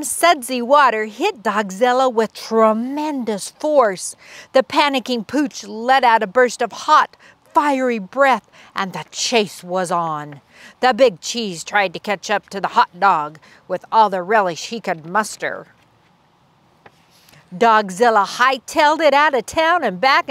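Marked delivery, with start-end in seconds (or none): none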